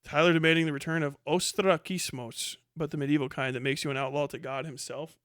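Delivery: ordinary delivery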